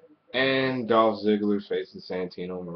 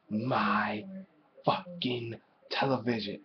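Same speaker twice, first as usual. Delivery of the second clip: hissed under the breath; whispered rant; 7 dB quieter, slower than usual. Real, not acted. Ranting at Vicky Guerrero, whispered contempt.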